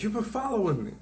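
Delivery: high-pitched mimicry of her accusation; accusing stranger. Woman accuses the man walking ahead, high-pitched mimicry. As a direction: imitate an agitated woman, pitched well up. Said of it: "she's like".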